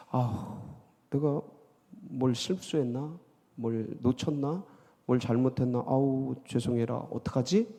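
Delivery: soft, musing self-doubt; humble self-questioning. Acted. Worshipper wondering what went wrong with the offering, softly self-questioning. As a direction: mull it over quietly, apologetically.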